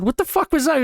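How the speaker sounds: baffled. Retold or imitated. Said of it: "I said".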